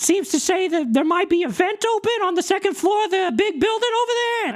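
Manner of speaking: high, chatty little critter; high rat voice. Acted. GM voicing Tony the rat answering the dog, high and breezy. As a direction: pitch it way up as a casual rat. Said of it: "Tony goes".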